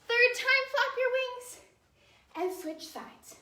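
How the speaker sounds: high, lilting dance cue, slowed; sing-song ballet teacher calling steps. Shown as a demonstration. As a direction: call the moves like counting music. Calling dance counts to class.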